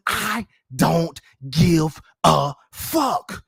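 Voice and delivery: very slow, every word set apart; defiant emphasis. Real, not acted. YouTuber defying his haters, slow and emphatic. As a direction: punch it out word by word.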